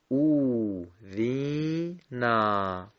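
او، وی، نا each stretched to two beats, slow; long vowels. Shown as a demonstration. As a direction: draw out او، وی، نا long.

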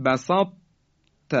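بسط held on a heavy unreleased ط, then a light ت; heavy ط closing into a separate light ت; incomplete merging. Shown as a demonstration. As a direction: keep the ط of بسط heavy and release it as ت. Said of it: "ببینید این درشتی".

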